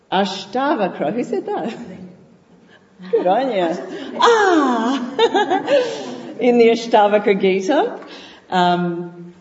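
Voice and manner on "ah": delighted ah. Acted. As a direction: exclaim it like sudden realization. Enlightenment exclamation, laughing.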